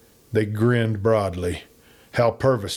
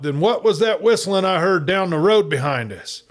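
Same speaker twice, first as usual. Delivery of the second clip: a raised, pitched-up cry; 9 semitones higher than usual. A narrator's reading. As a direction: call it out, startled and demanding. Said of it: "Then Silent cried out".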